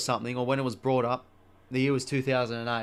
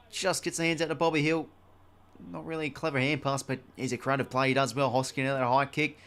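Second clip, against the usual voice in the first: brisk footy commentator call, a touch louder; play-by-play commentary; 4 dB louder than usual. Acted. Gamer calling his footy match, brisk commentary. Calls the game he plays.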